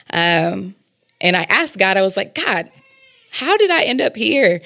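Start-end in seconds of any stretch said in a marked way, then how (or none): 2.3-4.6 high, pleading question to God. Retold or imitated. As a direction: ask God, lost and pleading.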